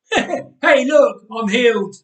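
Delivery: high, loud mock excitement; mock healed. A narrator's reading. Nathan faking a miracle for his family, loud and high. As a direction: play up a fake miracle.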